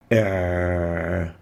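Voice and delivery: a squeezed ع from deep in the throat; like someone choking you; صوت العين الحلقي; choking throat sound. Shown as a demonstration. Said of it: "sounds like choking".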